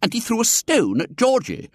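indignant mother. A narrator's reading. Mother complaining, high pitch.

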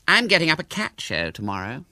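high-pitched, guileless announcement; innocent. A narrator's reading. Hubert announces his cat show to William, innocent. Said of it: "he said innocently".

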